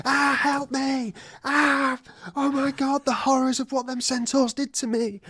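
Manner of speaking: high-pitched mock hysterics; hammed-up panic attack. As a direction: wail for help in exaggerated, high panic. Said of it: "She starts having a panic attack".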